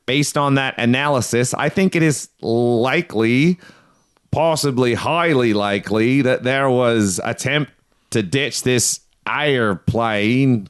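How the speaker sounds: labored, unrecognizable Australian attempt; strained fake Australian, slowing at the end; botched Australian accent. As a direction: struggle through a terrible Australian accent. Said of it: "Wait, that's your Australian accent?".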